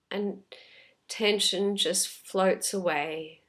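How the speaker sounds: unhurried, calm meditation voice with long pauses; guided meditation. Real, not acted. Teacher leading listeners through a body scan, calm guided meditation. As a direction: gently guide them inward, leaving space after each phrase.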